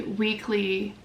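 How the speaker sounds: ordinary talk, nothing marked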